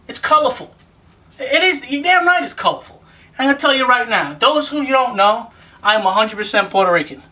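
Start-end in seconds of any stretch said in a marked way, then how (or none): 0.1-3.0 emphatic, rising. Real, not acted. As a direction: pitch climbing with each repeat. Defends colorful shirt, voice climbing.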